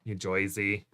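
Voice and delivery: put-on low, slow New Jersey accent, "Joisey"; drawn-out put-on New Jersey vowels. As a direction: say it softly in mock New Jersey. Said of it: "attempt your best New Jersey accent".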